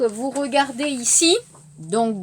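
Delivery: usual speaking voice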